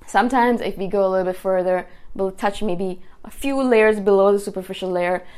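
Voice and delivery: ordinary talk, nothing marked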